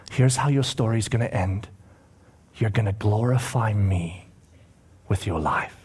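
low, softly falling promise; tender assurance. Acted. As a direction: give a quiet, solemn promise. Jesus tells Peter his ending.

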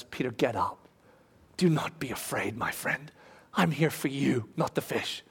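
very low, soft, tender voice; quietly calm a frightened friend; gentle reassurance. Retold or imitated. Jesus reassures kneeling Peter.

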